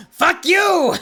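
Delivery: loud, high cursing yell; angry clown shout. Acted. Cursing another driver in a furious clown voice. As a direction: spit it at the driver. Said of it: "don't do that voice".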